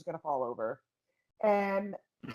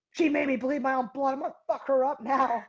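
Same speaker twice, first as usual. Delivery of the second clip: enraged; 5 semitones higher than usual. Retold or imitated. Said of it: "I'm like".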